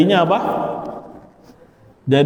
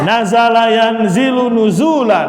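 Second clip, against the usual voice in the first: recitation drill; 5 semitones higher than usual. Shown as a demonstration. Teacher reciting the masdar gallery aloud, chanting. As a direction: recite each pattern clearly for the class.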